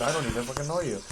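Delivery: rushed, fast throwaway line; too fast. Real, not acted. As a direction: blurt it out quickly. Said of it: "I said that way too fast".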